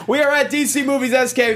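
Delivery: high, bright show-intro announce; announcer voice. Acted.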